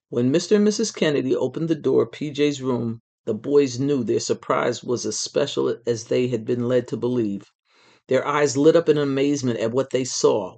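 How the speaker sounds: ordinary delivery, nothing marked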